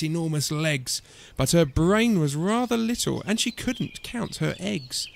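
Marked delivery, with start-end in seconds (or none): none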